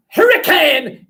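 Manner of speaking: high, loud yell; acted cry of alarm; shouted false alarm. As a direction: holler a warning to distract. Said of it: "I'll just shout something".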